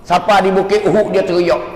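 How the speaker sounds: ordinary talk, nothing marked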